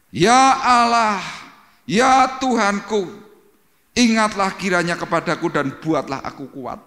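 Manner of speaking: loud, high, slow desperate prayer; crying out to God. Retold or imitated. Samson's final cry to God for strength, loud and slow. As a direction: call out to God with the last strength. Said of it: "dia berseru".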